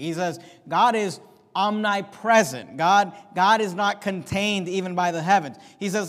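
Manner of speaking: ordinary delivery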